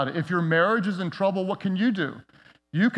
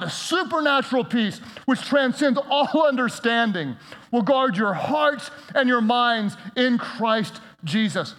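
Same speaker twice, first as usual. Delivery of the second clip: raised, fervent pulpit delivery; full-force preaching; 6 semitones higher than usual. Real, not acted. Pastor urging the congregation to pray boldly. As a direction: drive it home to the congregation.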